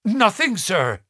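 a touch of nervous stammer on nothing; mild agitation. A narrator's reading. Butler caught at the window answers his master, mildly agitated. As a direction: caught out, blurting nothing with a jump up high. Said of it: "His agitation was so great".

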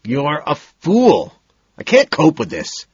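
high, loud, slow furious snarl; a loud, hammed-up furious outburst. A narrator's reading. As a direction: snarl at her in sudden fury. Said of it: "I snarl at her".

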